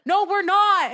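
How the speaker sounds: high, loud mock argument; retold denial. Friend contradicting her in front of guards, mock quarrelling. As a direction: imitate snapping back in a quarrel.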